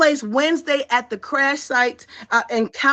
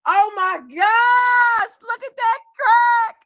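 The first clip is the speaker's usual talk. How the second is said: high, drawn-out excited shout; excited cheering; 7 semitones higher, much slower than usual. Real, not acted. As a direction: stretch the words out in amazed delight. Host cheering on her guest.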